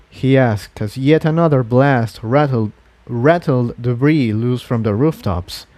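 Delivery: ordinary delivery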